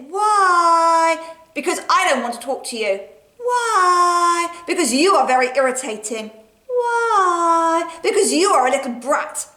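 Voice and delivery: high, loud, far-off nagging why; pestering little sister. Acted. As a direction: nag high and loud, over and over.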